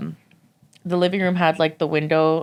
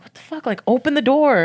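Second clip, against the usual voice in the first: freaked-out yell; 9 semitones higher than usual. Retold or imitated. Yelling at a shadowy face.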